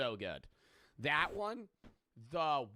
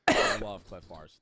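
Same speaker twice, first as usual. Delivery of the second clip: louder and slower, leaning on "love" in "I love Clif Bars"; emphatic declaration; 6 semitones lower, 11 dB louder, slower than usual. Real, not acted. Streamer declares love of the snack, loud and slow. Repeats his Clif Bar line.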